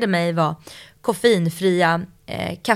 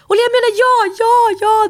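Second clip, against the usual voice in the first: much higher, louder squealed yes; overjoyed yes; 8 dB louder than usual. Retold or imitated. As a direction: shriek yes to Olle's proposal. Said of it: "Jag bara".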